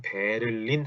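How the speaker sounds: "베를린" in three full Korean syllables; Korean-style reading. Shown as a demonstration. Teacher shows learners the Korean reading, "베를린" with a full 를. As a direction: pronounce every syllable of "베를린", no German end stress. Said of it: "우리나라 말로 읽으면".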